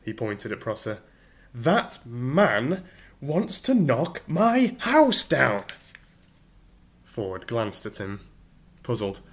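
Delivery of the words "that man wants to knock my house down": much higher, louder, very slow; indignant shout. Acted. Arthur pointing at Prosser, indignant shout. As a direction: hammer each word home.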